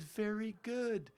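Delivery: high, loud, drawn-out praise; retold teacher's verdict. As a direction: repeat the teacher's praise in amazed high pitch. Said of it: "She said".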